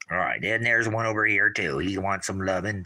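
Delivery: ordinary delivery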